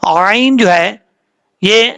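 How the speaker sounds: ordinary delivery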